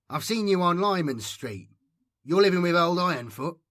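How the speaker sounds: put-on loud, high taunting boy; bully's sneer. A narrator's reading. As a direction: lean in and needle him. Craven voiced as bully.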